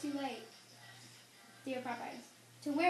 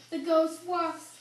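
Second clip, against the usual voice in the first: eerie ghost warning; 3 semitones higher, 7 dB louder, much slower than usual. Acted. Servant spooks master about ghost.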